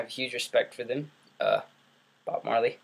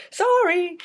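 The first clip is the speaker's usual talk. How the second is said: high, loud drawn-out apology; mock apology; 6 dB louder than usual. Apologizing to viewers after cursing, in a high mock voice. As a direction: squeal a loud, exaggerated sorry.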